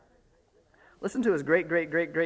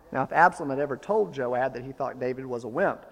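ordinary talk, nothing marked

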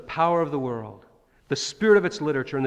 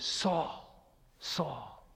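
hushed divine call; 9 dB quieter than usual. Retold or imitated. Voice on Damascus road.

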